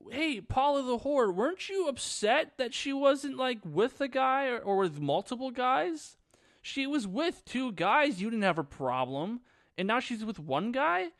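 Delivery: high-pitched, incredulous scolding; indignant challenge. Acted. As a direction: argue back in outraged disbelief. Indignantly challenges Paula in story.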